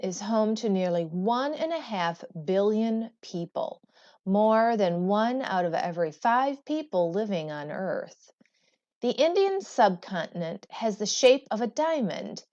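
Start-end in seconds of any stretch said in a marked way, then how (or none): none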